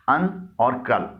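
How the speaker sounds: अन और कल said slowly, each syllable apart; split into syllables. Shown as a demonstration. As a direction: break अन और कल into two slow beats. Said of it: "दो हिस्सों में तकसीम कर सकते".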